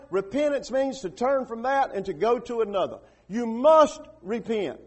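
high, loud, driving sermon delivery; forceful preaching. Real, not acted. Preacher explaining repentance to congregation, forceful high-pitched preaching. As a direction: drive each point home to the congregation.

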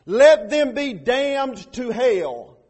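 slow, loud, weighty words; solemn curse. Acted. Pastor reading Paul's curse on false gospels, slow and loud. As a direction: let it land like doom.

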